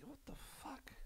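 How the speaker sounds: whispered what the fuck. Real, not acted. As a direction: hush it out in disgust. Disgusted aside.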